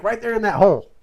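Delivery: high, loud eager pointing; excited kid brother. Retold or imitated. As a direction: blurt it out like a kid sure he sees a deer. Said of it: "He's like".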